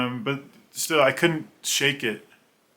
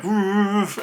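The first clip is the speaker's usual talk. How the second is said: excited exclamation; 7 semitones higher than usual. Retold or imitated. Taking control of the plane.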